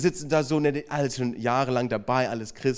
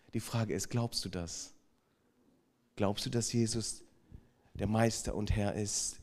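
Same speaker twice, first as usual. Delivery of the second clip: intimate sermon appeal; 4 semitones lower, 8 dB quieter than usual. Acted. Preacher quietly asks the congregation about faith, soft and earnest. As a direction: draw each listener in, quietly.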